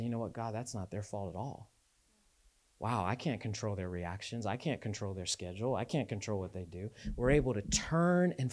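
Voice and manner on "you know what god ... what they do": quiet humble prayer. Retold or imitated. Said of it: "I say".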